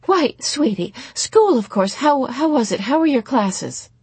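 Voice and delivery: high, loud, chirpy questioning; over-eager mother. A narrator's reading. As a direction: fuss eagerly over a child's school day. Mother eagerly questions her daughter.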